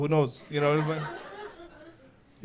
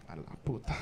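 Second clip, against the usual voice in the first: sighing, much softer curse in Spanish; muttered Spanish curse; 5 semitones lower, 10 dB quieter than usual. Acted. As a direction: breathe out the curse under your breath. Hero sighs in Spanish.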